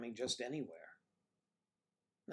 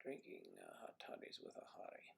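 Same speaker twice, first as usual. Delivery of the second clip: low whispered mutter, under the breath; hushed private mumble; muttered to self; 15 dB quieter than usual. Real, not acted. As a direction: mutter it quietly to yourself while puzzling it out.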